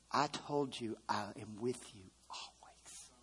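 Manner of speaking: soft whispered refrain; hushed whispered reassurance. Acted. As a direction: whisper it like a secret promise. Whispered refrain between lines.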